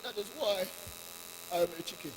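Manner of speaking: faint, fading words of a dying chicken; dying uncle. A narrator's reading. Dying uncle chicken telling the young one, faintly. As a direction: let the breath run out as he dies. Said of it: "he's saying this thing and he's dying".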